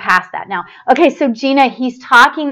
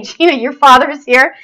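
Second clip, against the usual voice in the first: ordinary talk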